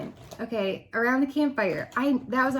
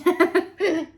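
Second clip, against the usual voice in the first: sudden high laugh, louder; high burst of laughter; 7 semitones higher, 6 dB louder than usual. Real, not acted. Vlogger laughing at her own bad decision, high loud laugh.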